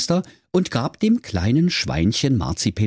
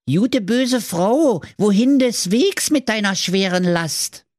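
high, loud piglet voice; little pig; 12 semitones higher, 3 dB louder, slower than usual. A narrator's reading. Pinky the piglet addresses the gingerbread witch, piglet voice. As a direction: call out high to the witch, stretching the opening words. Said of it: "Die Pinky sprach zur Knusperhexe".

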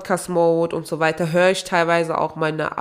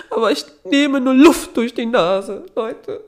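higher, louder, laughing protest; indignant protest; 7 semitones higher, 6 dB louder than usual. Real, not acted. Podcaster protesting to listeners, higher and louder.

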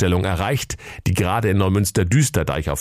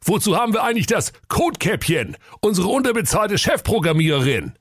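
raised high, a hammed-up bellow; indignant brother, pitched up; angry Jakob; 12 semitones higher than usual. Jakob furious about the programmer, mock bellowing. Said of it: "brüllte der Jakob Grimm wütend".

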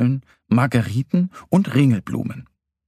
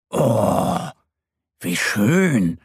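admiring little bear; 2 semitones lower, much slower than usual. A narrator's reading. Mo seeing the coloured eggs, admiring. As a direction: marvel with a drawn-out oh. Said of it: "ruft Mo".

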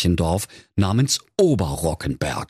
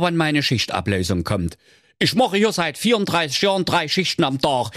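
lightly voiced weary vendor; tired vendor; 10 semitones higher than usual. A narrator's reading. Vendor asking Schnitzelschorsch a favour, a hint of fatigue. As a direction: grumble like a worn-out vendor.

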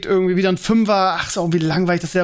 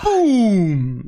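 long drawn-out falling boom; mock explosion sound; explosive boom; 2 semitones higher than usual. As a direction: stretch it out like a dropping bomb. Punchline after the repair price.

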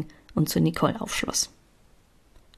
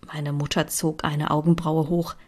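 ordinary delivery

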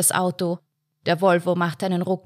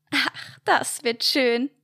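sighing; 6 semitones higher, slower than usual. A narrator's reading. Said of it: "sie seufzte".

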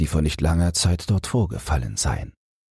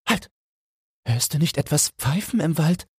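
much higher, startled and fearful; anxious call; 12 semitones higher than usual. Acted. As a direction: freeze and listen, scared. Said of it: "rief Felix ängstlich".

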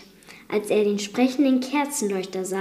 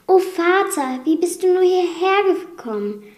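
high, anguished cry to father; distressed surprise; 9 semitones higher, 4 dB louder than usual. Acted. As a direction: cry out in shock at finding him. Belle findet Vater im Kerker. (bestürzter hoher Ausruf)

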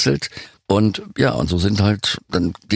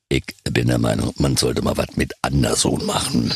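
much lower, mock deep voice; imitating the producer; 8 semitones lower than usual. Singer quotes producer Thomas Meisel in a deep mock voice. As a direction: drop into a low, self-satisfied producer voice. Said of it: "drehte sich den Bauch und sagte".

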